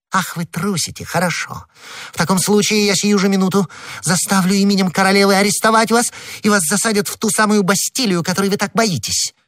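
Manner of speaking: high-pitched, heated threat; indignant wife laying down an ultimatum; threatening ultimatum. Acted. Wife threatens husband with arrest.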